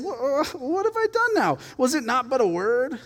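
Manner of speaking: high-pitched, mock-innocent protest; put-upon little brother; indignant protest. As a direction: stammer it out high, as if wrongly accused. Reading David's protest to his brother.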